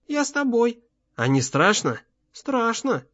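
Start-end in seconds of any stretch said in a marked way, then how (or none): 0.1-0.8 put-on small boy pleading to come along; little boy. A narrator's reading. Boy answers his father. 2.3-3.0 put-on «Страшно» in a high, soft child's voice; small, high-pitched boy admitting fear; little boy. A narrator's reading.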